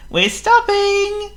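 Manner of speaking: very high, slow call. Acted. Thomas calling back to the trucks, high and slow. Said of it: "called Thomas".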